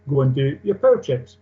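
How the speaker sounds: ordinary talk, nothing marked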